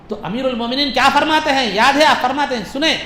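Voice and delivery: usual speaking voice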